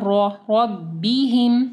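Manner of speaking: रॉ with a thick, rounded r, not रा; slow रब्बिहिम, opening on a full heavy रॉ. Shown as a demonstration. Teacher corrects a light ra for students, heavy ra. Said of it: "रॉ को heavy पढ़ना है".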